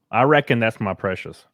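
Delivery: a hint of Southern boy, fast and playful. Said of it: "But in a redneck voice".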